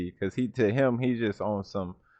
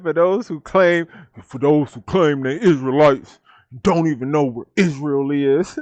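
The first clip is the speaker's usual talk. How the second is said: mock-quoting, louder and higher; quoting the dude; 3 semitones higher, 5 dB louder than usual. Echoing the man from the video, loud mock quote. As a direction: ham up his line with loud disbelief. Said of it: "This dude said".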